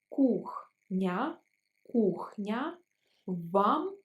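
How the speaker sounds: each word slowly, twice over; slow syllable drill. Shown as a demonstration. Teacher slowing the polite question for students. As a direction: spell it out slowly for learners. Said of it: "Let's pronounce it slower".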